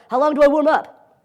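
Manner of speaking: high, loud, drawn-out student voice; exaggerated questioning student; mock student question.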